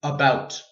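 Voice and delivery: "About" with a short weak "uh" at the start; schwa in about. Shown as a demonstration. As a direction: open "About" on a quick lazy schwa. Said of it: "We hear it in these words here".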